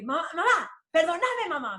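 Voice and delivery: imitating a grudging, unrepentant plea; sulky apology. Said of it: "me dice así mal, con una actitud". (disculpa imitada de mala gana)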